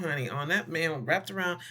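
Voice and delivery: ordinary delivery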